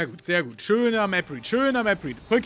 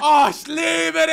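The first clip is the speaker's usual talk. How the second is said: drawn-out shout of love; 5 semitones higher, much slower than usual. Real, not acted. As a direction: stretch it out in delight. Ecstatic reaction to the play.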